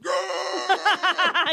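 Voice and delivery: high laughing cheer. Retold or imitated. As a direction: cheer the dog on gleefully. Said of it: "I was like".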